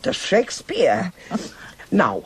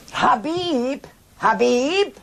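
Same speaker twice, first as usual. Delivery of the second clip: calling out a name, pitch up; 9 semitones higher, slower than usual. Acted. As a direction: call for Habib across the house. Calling out for Habib.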